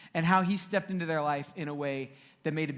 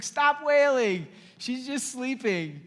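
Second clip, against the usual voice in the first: higher, slow call of stop wailing; Jesus at the window; 6 semitones higher, 3 dB louder, slower than usual. Retold or imitated. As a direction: lean out and call to the mourners. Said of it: "He's just like".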